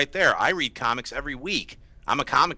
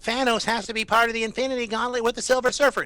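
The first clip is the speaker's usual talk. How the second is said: high, nasal know-it-all voice; mocking nerdy fan; 12 semitones higher than usual. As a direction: parody a nitpicking superfan. Mocking a nitpicking comic fan.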